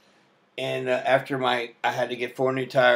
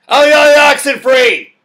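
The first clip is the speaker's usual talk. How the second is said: high, loud mock-silly voice; sarcastic high mock yell; mocking judge; 10 semitones higher, 13 dB louder than usual. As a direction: parody the judge's nonsense.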